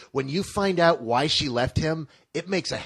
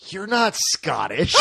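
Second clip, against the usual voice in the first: retold dismay; 5 semitones higher, 4 dB louder, slower than usual. She just looks at him and goes.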